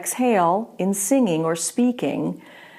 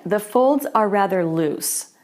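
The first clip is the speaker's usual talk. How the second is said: ordinary talk, nothing marked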